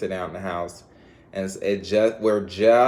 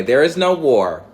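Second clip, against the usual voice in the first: emphatic; 5 semitones higher, 9 dB louder than usual. Acted. Emphatic slow proclamation.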